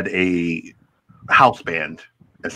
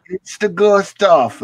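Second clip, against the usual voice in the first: put-on Jamaican accent; 10 semitones higher, slower than usual. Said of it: "he goes".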